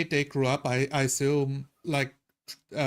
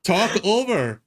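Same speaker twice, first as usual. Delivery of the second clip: ordinary talk, nothing marked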